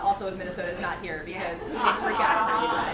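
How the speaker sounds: normal talking voice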